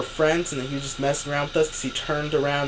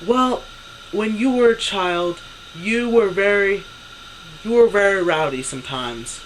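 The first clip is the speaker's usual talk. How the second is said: imitating mom's slightly raised voice; imitated raised voice; 4 semitones higher than usual. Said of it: "she raises her voice more and more".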